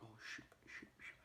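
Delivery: whispered, soft panic; panicked whisper. Retold or imitated. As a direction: hiss the curses in fear. Louts panicking.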